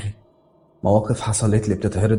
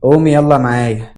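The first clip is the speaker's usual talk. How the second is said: raised, insistent nurse's order in a thick voice; thick-voiced nurse; 3 semitones higher, 4 dB louder than usual. A narrator's reading. Nurse summoning the patient to the doctor, thick voice. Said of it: "وهي بتقول بصوت تخين".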